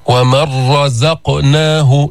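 ومن رزقناه with no nun heard, a doubled ra; nun merged into ra. Shown as a demonstration. Teacher demonstrating the nun merged fully into the ra. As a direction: slowly swallow the nun into the ra of رزقناه. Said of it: "شددنا الراء".

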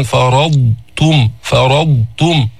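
فرضتم said very slowly with a full ض; slow careful dad. Shown as a demonstration. Teacher models فرضتم for the class, very slowly. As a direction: stretch فرضتم syllable by syllable. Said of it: "تقول مثلًا".

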